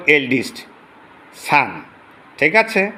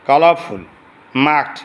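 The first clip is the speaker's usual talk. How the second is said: ordinary talk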